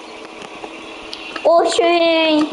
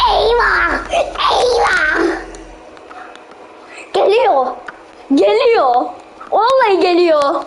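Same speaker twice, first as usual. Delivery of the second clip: panicked crying out; 3 semitones higher than usual. Real, not acted. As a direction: cry out as it closes in. Monster approaching, panic.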